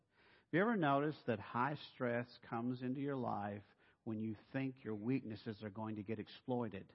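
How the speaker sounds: ordinary talk, nothing marked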